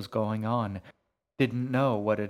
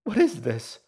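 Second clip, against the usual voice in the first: high, loud, distraught cry; anguished, sobbing question; sobbed; 8 semitones higher, 6 dB louder than usual. A narrator's reading. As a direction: cry out in tearful confusion. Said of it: "she sobbed".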